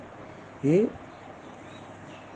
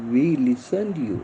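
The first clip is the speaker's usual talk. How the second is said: slow model sentence; much slower than usual. Shown as a demonstration. Teacher models English answer.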